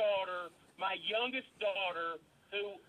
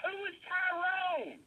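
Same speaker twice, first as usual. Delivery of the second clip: shouting; 8 semitones higher, slower than usual. Real, not acted. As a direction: yell the question. Father shouting demand.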